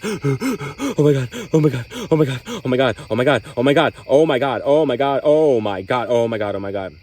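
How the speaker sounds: embodied panic attack, easing off at the end; acted panic. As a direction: spiral into panic, voice climbing. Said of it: "That was me kind of embodying that".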